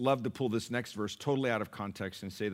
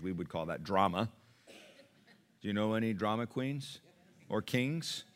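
ordinary delivery

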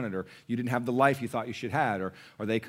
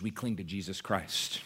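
normal talking voice

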